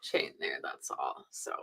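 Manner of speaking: whispering. Real, not acted. Quiet aside during squeaking demo.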